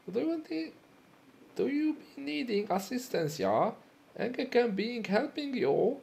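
a broken-English German trader; German shopkeeper accent. Acted. Offering help to the customer in a high German accent. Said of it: "might be German".

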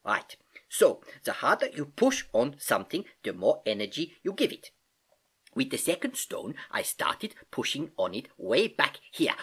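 put-on accented uncle teaching, key words lifted; patient Uncle Albert, explaining. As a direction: explain it like an eager old professor uncle.

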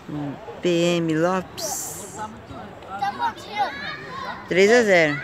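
announcer calling the score; score announcement. Real, not acted. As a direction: read the scoreline like a broadcaster. (anúncio de placar)

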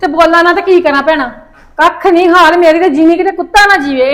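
normal talking voice

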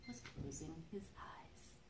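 soft bedtime whisper as he drifts off. A narrator's reading. Librarian to children, a hushed bedtime whisper. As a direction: breathe it out like sleep is near.

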